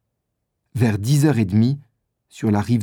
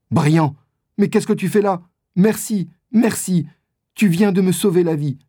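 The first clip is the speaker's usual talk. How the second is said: put-on grateful rescued boy; 8 semitones higher than usual. A narrator's reading. Doniphan rescued, thanking Briand.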